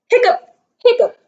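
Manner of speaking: squeaky high hiccup sound; a sudden high-pitched hiccup. Acted.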